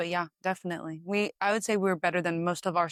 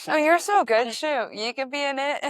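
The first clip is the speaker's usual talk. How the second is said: cooing at a pet; 7 semitones higher, 5 dB louder than usual. Real, not acted.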